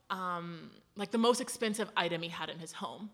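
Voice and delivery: ordinary talk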